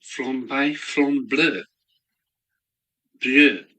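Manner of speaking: half-joking French pronunciation of "flambe bleu"; mock French. Shown as a demonstration. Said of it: "Sounds very French".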